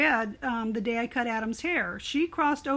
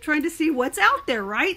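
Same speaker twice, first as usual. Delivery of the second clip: ordinary talk, nothing marked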